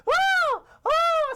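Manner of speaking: piercing, very high loud shriek; cartoon scream. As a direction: scream at full pitch like a terrified cartoon. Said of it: "It's still going on".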